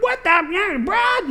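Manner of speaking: very high, shrill-pitched mock yell of a name; girlfriend yelling. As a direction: call out high like a panicked girlfriend. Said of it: "yelling, like".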